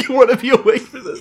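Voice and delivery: high, laughing, leering; sleazy movie guy. Retold or imitated. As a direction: leer it with a laugh. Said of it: "he's like".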